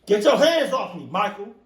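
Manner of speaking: high, loud acted protest; mock outraged yell. Host pretending to fend off Michael, outraged yell.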